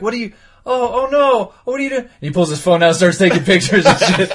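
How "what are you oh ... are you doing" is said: mock shocked ghost. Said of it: "He's like".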